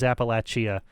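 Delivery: Appalachia with an extra syllable, LATCH-ee-uh; wrong pronunciation. Shown as a demonstration. Streamer ruling out one variant, Appalachia mispronounced "ap-uh-LATCH-ee-uh". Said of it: "The only one that's wrong is".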